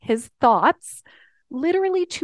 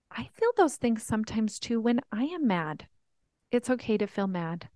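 ordinary talk, nothing marked

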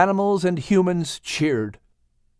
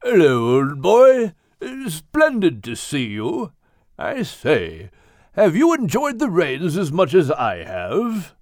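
hearty English whale; jolly whale voice. A narrator's reading. Whale greeting Max after the rescue, hearty and jolly. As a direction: greet him like a jolly old gent. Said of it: "Craddock asked".

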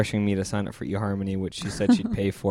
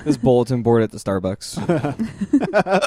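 slow drawn-out mock announcement; 3 semitones higher, 5 dB louder, much slower than usual. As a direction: draw it out slowly like a joke. Imagined meeting place answer.

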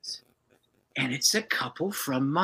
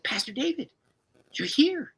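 amazed greeting; 12 semitones higher than usual. Retold or imitated. Couple amazed at pastor on doorstep.

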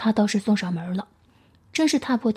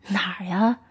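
slow, modest laugh. A narrator's reading. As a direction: chuckle and wave off the praise. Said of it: "那人笑了笑".

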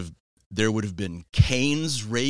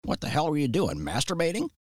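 much higher grandma voice; high-pitched, blunt grandmotherly question; imitated grandma; 8 semitones higher than usual. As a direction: imitate a grandma demanding an explanation. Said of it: "And she goes".

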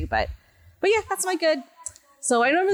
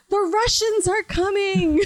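high, drawn-out mock warning cry; alarmed old man; 9 semitones higher, slower than usual. Old man runs out raising alarm.

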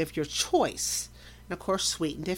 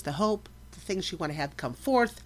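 ordinary talk, nothing marked

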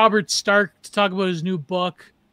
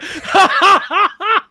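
loud laugh; 11 semitones higher, 5 dB louder than usual. Real, not acted. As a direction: burst of high laughter. Laughing at the joke.

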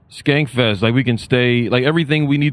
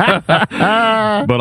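laughing; 8 semitones higher than usual. Real, not acted. Laughing at own joke.

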